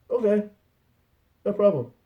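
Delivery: Ted's brother. Acted. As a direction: agree to it all without a question. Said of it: "his brother's just like".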